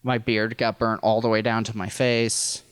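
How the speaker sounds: normal talking voice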